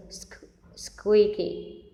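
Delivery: slow, careful "squeaky" with sk- first; corrected pronunciation. Shown as a demonstration. Teacher corrects her earlier "squeaky", slowly. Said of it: "vừa nãy mình đọc sai từ này".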